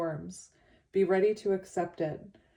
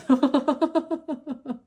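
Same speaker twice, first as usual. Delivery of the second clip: high tearful laugh; teary laugh; 8 semitones higher, 7 dB louder than usual. Real, not acted. Reader laughing at herself for crying, teary laugh. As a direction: laugh through sudden tears.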